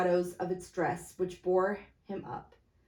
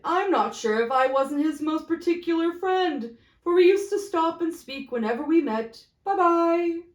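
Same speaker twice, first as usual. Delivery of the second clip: higher, louder sly boast; smug merchant; 5 semitones higher, 7 dB louder than usual. Acted. First merchant boasting to the group, higher and louder. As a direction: play the generous friend.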